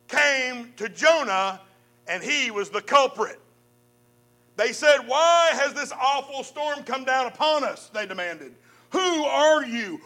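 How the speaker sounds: measured, dramatic Bible reading; preacher reading scripture with rising drama. Acted. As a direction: read the story to the congregation. Reads Jonah's story aloud.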